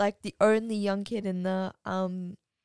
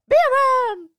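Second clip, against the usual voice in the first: mock yelling; 13 dB louder than usual. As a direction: bellow it like an exaggerated scolding mum. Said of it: "your mum yelled at me".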